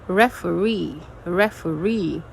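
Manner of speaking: "referee" with a long, stressed final -ree; light stress on the first syllable of "referee", main stress on the last; stress demo on referee. Shown as a demonstration. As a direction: say "referee" as a teacher modelling end stress. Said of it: "it's a little bit stressed".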